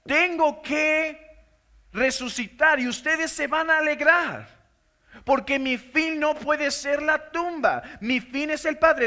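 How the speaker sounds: impassioned preacher voicing Christ; fervent preaching. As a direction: proclaim it like a sermon's climax. Preaching in Jesus' voice.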